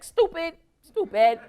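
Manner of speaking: high, exasperated scold; mock-exasperated "stupid, stupid". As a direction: chide him like a fool. Wife scolds husband.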